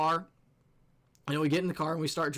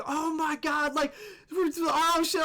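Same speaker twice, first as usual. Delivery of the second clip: very high mock panic; freaking-out girlfriend; 10 semitones higher, slower than usual. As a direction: imitate a panicking girlfriend, pitched way up. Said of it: "my girlfriend is freaking out".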